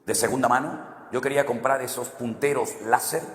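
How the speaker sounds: ordinary delivery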